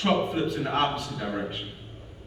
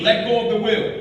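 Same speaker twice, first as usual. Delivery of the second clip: raised, higher voice of command; urgent command; 6 semitones higher, 5 dB louder, faster than usual. Retold or imitated. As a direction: call out an inner order. Spirit's urgent command in retelling.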